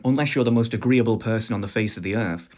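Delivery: ordinary talk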